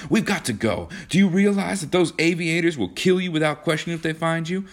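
high, pressing warning; insistent, alarmed urging; urgent cry. A narrator's reading. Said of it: "John cried".

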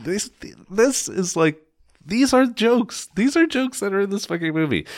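high-pitched, incredulous exasperation; exasperated disbelief. Real, not acted. Podcaster despairing to co-host over the movie's jokes, exasperated. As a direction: voice climbs high in helpless exasperation.

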